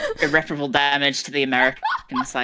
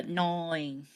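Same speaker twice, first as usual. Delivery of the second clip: put-on Australian accent; 3 dB quieter, much slower than usual. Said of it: "I don't have the".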